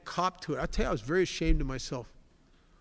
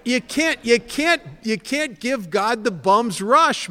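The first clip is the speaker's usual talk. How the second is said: emphatic loud peak; 7 semitones higher, 11 dB louder, slower than usual. Real, not acted. To the crowd about giving God the bum's rush, louder and high. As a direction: voice climbing loud and high, slow on the line.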